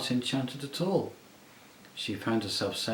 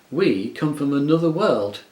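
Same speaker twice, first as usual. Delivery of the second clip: louder, stamping her foot; insist, cross and stubborn; indignant; 4 semitones higher, 8 dB louder than usual. A narrator's reading. Jill, indignant at the witch, stamping her foot. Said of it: "stamping her foot".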